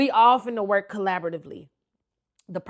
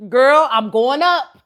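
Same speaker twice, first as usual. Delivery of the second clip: retold alarm; 4 semitones higher, 14 dB louder, slower than usual. Telling the nurse she's panicking.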